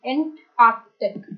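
एंटार्क्टिक said slowly, the c sounded; slow model of एंटार्क्टिक; careful slow pronunciation. Shown as a demonstration. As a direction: take care over every sound in एंटार्क्टिक. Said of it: "ध्यान रखने में".

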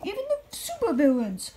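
normal talking voice

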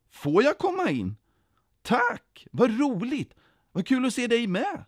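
very high, amazed joy; delighted. A narrator's reading. Den som får komma in tackar, delighted. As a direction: squeal your thanks in happy disbelief. Rejected one delighted to get in.

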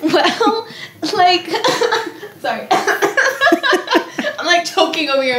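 choking back laughs, words squeezed out high; trying not to laugh mid-sentence; stifled laughter. Real, not acted. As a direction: talk while fighting off a giggle. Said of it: "I'm trying not to laugh".

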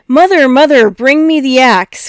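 loud, high desperate call for help; urgent shout. Acted. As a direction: yell to mother from below. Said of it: "cried Jack".

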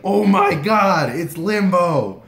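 loud, high, drawn-out fan cry; disbelieving excitement. Retold or imitated. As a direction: stretch out each word, amazed by your idol. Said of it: "I just couldn't bel".

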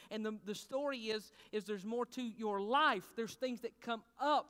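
usual speaking voice

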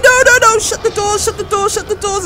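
high, loud "no, no, no" then urgent orders; real panic, begging; panicked pleading. Real, not acted. Player yelling at the doors to shut in panic.

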